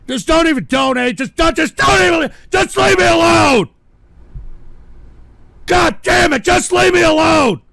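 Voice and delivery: high, loud desperate yelling; furious shouting. Real, not acted. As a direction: build to a bellowed demand. Snapping, demands to be left alone.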